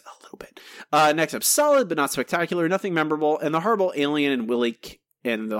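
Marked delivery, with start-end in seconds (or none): 0.1-0.6 breathy whispered aside; mock confidential whisper. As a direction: whisper it like a secret tip. Same advice repeated, whispered.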